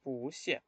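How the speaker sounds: soft "Pu xie", "Pu" rising, "xie" falling; second-tone pu. Shown as a demonstration. Teacher repeating the tone change, "Pu xie" with rising Pu. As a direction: lift "Pu", then drop "xie", softly. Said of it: "El cambio sería a segundo tono".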